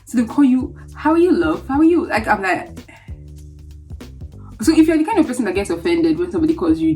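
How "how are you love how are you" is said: high, swooping friendly hello; imitated local greeting. Locals calling out to a newcomer, high lilting imitation. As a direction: mimic a breezy local greeter.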